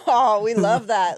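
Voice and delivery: loud, laughing sarcastic approval; big sarcastic cheer, breaking into laughter; mock delight. Real, not acted. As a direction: gush it loudly through a laugh. Mock delight at a blackface jab.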